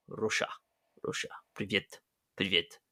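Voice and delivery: quiet, testing a Russian accent; practice Russian accent. Shown as a demonstration. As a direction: softly rehearse a fake Russian accent.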